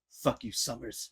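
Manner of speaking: low, soft and slow mock snarl; a hint of a surly Wolverine; imitating Wolverine's growl. Fan doing Wolverine's line to Cyclops, low and slow. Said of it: "I need to hear Cavill ... go".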